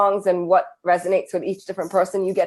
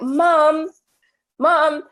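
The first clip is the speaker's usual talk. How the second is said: put-on high, calling out Mom, Mom like an impatient child; a child tugging for mom's attention, pitched up; lightly voiced child calling Mom; kids calling mom; 8 semitones higher, 3 dB louder than usual. Children calling their mother.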